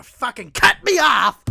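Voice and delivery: building to a loud, indignant yell; worked-up road-rage retelling; exasperated outburst. Retold or imitated. Reliving being cut off in traffic.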